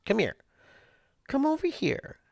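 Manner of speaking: very high coaxing pet call; calling the cat. Real, not acted. Owner coaxing the cat to come, very high pet voice.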